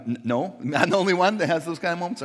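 normal talking voice

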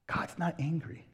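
whisper. Acted. Intimate reassurance after sermon point.

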